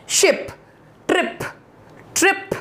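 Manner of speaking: शिप and ट्रिप with a quick short i; शिप, ट्रिप as brief one-syllable words. Shown as a demonstration. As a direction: clip the vowel in शिप, ट्रिप. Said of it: "छोटी आई".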